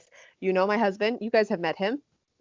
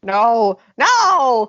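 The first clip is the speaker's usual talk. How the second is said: loud protest; 7 dB louder than usual. Real, not acted. Outraged at husband's remark.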